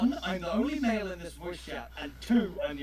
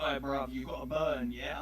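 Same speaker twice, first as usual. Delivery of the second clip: lower, softer London lad asking for a smoke; hint of a South London accent; mock London slang; 7 semitones lower, 5 dB quieter than usual. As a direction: mumble it like a street geezer. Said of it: "they're like".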